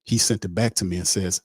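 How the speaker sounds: usual speaking voice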